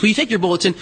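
normal talking voice

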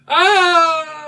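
high, loud scream as it falls; falling robot scream. Acted. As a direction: scream while falling into a pit. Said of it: "as he walks and goes".

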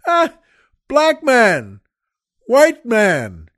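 high, loud imitated holler; shouting to friend. Retelling a stranger calling to his friend, shouted. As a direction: call out like the stranger announcing people. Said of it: "he shouted out to a friend".

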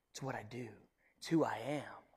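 soft unvoiced whisper. Preacher whispering God's self-description to the congregation. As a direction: hush it like a confession. God's nature, voiced softly.

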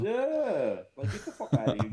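high laughing yeah into a dismissal; real laughter breaking through the words. Real, not acted. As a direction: laugh it out and wave him off. Laughing agreement, mock dismissal.

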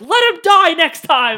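high, loud slow mocking voice. Teasing friend mockingly, high and loud. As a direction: squeak it out to mock him.

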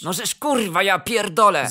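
loud swearing in exasperation; exasperated despair. A narrator's reading. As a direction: curse loudly, at your wits' end. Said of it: "załamał się trener". (załamany, głośno klnie)

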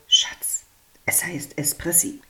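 husband whispers a correction about Espressi; whispered correction. Acted. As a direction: hiss the fix discreetly.

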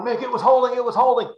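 high, loud, breathless mock protest; excited insistence. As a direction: imitate an overexcited ex-safety grabbing your shirt. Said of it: "he's grabbing me going".

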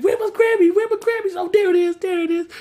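very high, frantic squeal; mock freak-out. Steve searching for his Grammy, mock freak-out. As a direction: squeal it in hammed-up panic. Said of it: "Steve was freaking out".

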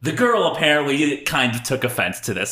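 usual speaking voice

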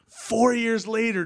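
ordinary delivery

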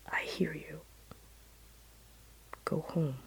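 very soft, near-whispered reply; mysterious voice. Retold or imitated. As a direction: barely voice it, calm and spare. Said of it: "And the voice said".

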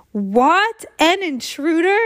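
loud, rising astonished question; shocked disbelief. A narrator's reading. Student hears about an intruder, shocked disbelief. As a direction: echo the news in shock. Narrator reacts to news of intruder.

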